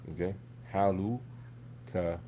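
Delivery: ordinary delivery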